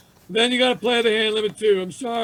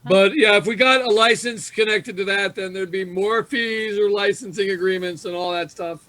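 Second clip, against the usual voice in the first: normal talking voice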